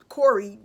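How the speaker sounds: Corey punched out loud; stress on Corey. Real, not acted. Teller stressing the boyfriend's fake name Corey. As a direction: hit the name Corey hard.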